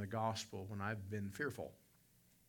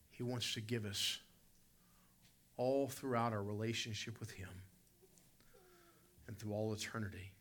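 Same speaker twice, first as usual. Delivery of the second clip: usual speaking voice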